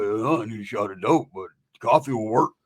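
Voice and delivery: low, sleepy drone; low-pitched, half-awake grumble; Roach's groggy voice. Retold or imitated. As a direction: mumble like a man just woken. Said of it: "Roach would lose his groggy voice".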